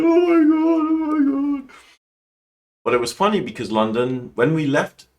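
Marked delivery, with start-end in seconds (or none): 0.0-1.7 loud, very high mock wail; acted crying. She retells crying on the flight, hammed-up wailing. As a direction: re-enact sobbing out loud, pitched way up. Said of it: "I cried on the flight".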